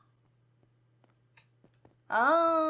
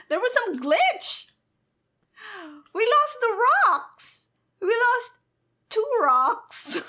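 much higher and louder outcry; dismayed shout; 9 semitones higher, 6 dB louder than usual. Real, not acted. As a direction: wail at the loss, half in disbelief. Game glitch loses the rocks.

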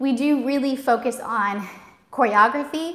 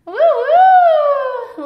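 high, loud whooping cheer; excited woo; 9 dB louder than usual. Real, not acted. Teacher cheering a viewer's comment, high and whooping. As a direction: whoop with delight at the chat.

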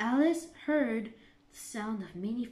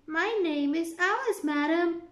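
put-on a bit higher, polite girl; lightly voiced Alice; 6 semitones higher, 6 dB louder than usual. A narrator's reading. Alice answers the Queen politely. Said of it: "Alice answered".

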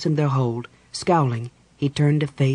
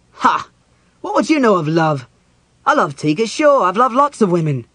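loud, high, indignant outburst; angry scoffing; 6 semitones higher, 7 dB louder than usual. A narrator's reading. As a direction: scoff and throw it back loudly. Lovers' quarrel, Caramon scoffs.

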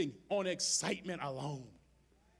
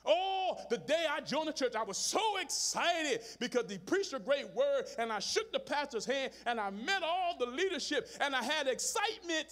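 rising preaching; 7 semitones higher than usual. Acted. Preacher building excitement.

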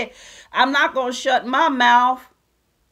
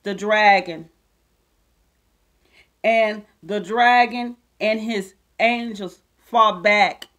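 slow, solemn Bible reading; scripture reading; 2 semitones lower, slower than usual. Acted. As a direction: read it out like a sermon. Reads scripture slowly.

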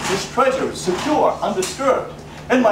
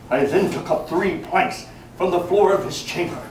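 plain reading voice

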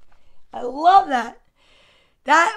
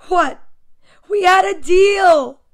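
loud, higher, drawn-out protest; indignant shout; 6 semitones higher, 9 dB louder, slower than usual. Acted. Story character protests about the deal, indignant shout. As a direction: protest loudly, stretching the words. Acted quarrel after the mess.

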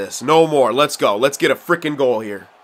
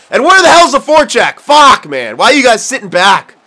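louder, much higher angry outburst; angry rant; 7 semitones higher, 7 dB louder than usual. Real, not acted. Gamer furious at his AI teammates for sitting back, angry yell. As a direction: yell at your useless teammates. Yelling at his own players.

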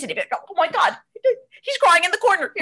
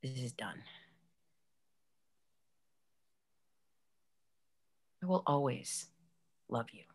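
quiet sad farewell; 13 semitones lower, 15 dB quieter than usual. Acted. Tender farewell.

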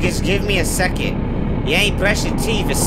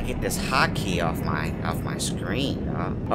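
muttered under breath; 14 semitones lower, 8 dB quieter, slower than usual. Real, not acted. Muttering while sneaking.

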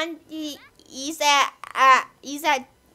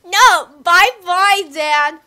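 loud, cheeky farewell; loud playful goodbye; 4 semitones higher, 12 dB louder than usual. Acted. Kid voicing Nemo leaving.

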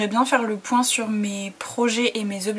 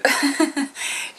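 tired laugh; 6 semitones higher than usual. Real, not acted. Laughs at day ending.

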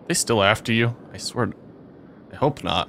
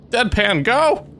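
frantic urging; 12 semitones higher, 3 dB louder, slower than usual. Real, not acted. Urging his fleeing character on.

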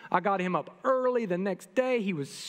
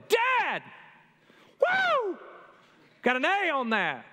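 put-on much higher, whooping kid over the phone; pumped son; 10 semitones higher, slower than usual. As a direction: imitate your son bursting with pride at his grade. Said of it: "He was pumped".

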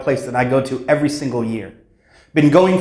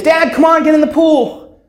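put-on child's voice; 13 semitones higher, 4 dB louder than usual. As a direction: call to dad like a small child begging. Child again.

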